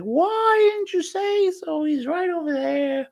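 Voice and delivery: very high, slow falsetto mother turning sweet; suddenly all welcome, like a mum spotting gifts; Brian's mum voice. Retold or imitated. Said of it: "she's like".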